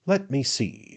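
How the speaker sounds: high-pitched, affected pondering; musing attorney. A narrator's reading. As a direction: pretend to search your memory. Attorney feigns forgetting, fishing for drink.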